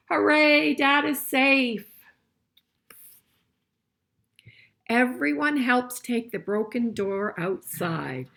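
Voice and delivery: loud, slow cheer for the rescue; joyful read-aloud cheer; excited story reading. A narrator's reading. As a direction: celebrate the rescue out loud for the kids. Reads the picture book aloud.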